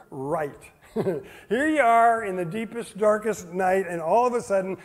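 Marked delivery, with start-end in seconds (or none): none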